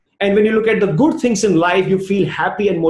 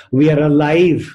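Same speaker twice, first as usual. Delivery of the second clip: ordinary delivery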